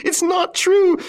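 piercing, high-pitched scream of disbelief. A narrator's reading. Wife denying the theft to her husband, screaming. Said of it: "she screamed".

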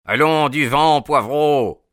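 gruff, angry order to clear off; growled dismissal. A narrator's reading. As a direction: chase off a nuisance, loudly. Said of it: "gronda une voix".

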